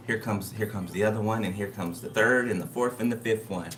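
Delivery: ordinary talk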